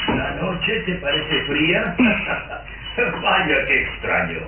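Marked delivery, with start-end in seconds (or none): 2.0-3.2 short breathy scoffing laugh; a dry unvoiced chuckle at her excuse; mocking laugh. Father scoffs at his nervous daughter with a dry laugh.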